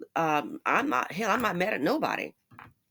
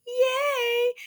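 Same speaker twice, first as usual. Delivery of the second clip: high, sung-out yay, much higher; mock cheer. Cheering her own B in a high mock cheer. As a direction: celebrate like a kid. Celebrating a B grade.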